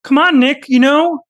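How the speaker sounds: high, pleading lecturer voice held right through; earnestly channel Jordan Peterson defending young men; Jordan Peterson impression. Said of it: "who's better at impressions?".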